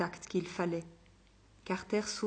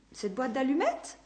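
echoing question; 4 semitones higher than usual. Acted. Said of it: "dit-elle en écho".

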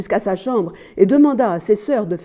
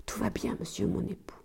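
barely audible, submissive whisper; meek whisper; 17 dB quieter than usual. A narrator's reading. Wife reassuring her interrogating husband, meek whisper. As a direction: shrink the reply almost to nothing.

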